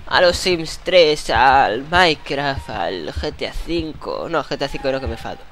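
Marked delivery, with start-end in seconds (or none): 0.1-4.3 drawn-out listing. Real, not acted. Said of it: "listing games, drawn out".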